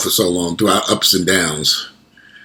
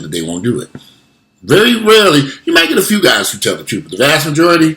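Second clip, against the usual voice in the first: usual speaking voice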